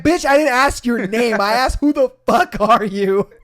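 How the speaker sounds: annoyed snap. Retold or imitated. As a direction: put her in her place, loud.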